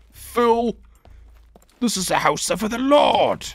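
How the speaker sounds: booming high mock voice of a self-declared lord; mock deity. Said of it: "I'm joking, obviously".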